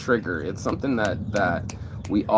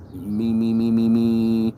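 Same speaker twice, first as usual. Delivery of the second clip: mocking me-me-me; 3 dB louder, slower than usual. Mocking a self-obsessed name.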